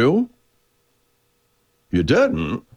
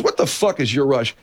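higher, blunt disbelieving question; sergeant's retort; 8 semitones higher than usual. Retold or imitated. The sergeant challenging the colonel, a bit higher, blunt. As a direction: fire it off straight and exasperated. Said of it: "He goes".

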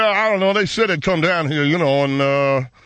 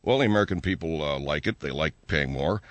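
much lower, softer sleazy tycoon; deep gruff businessman; 10 semitones lower, 5 dB quieter than usual. Acted. Oil analyst character voice.

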